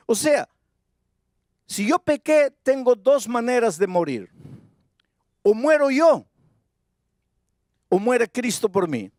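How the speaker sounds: impassioned sermon delivery; preaching at full force. Acted. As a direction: drive the point home from the pulpit.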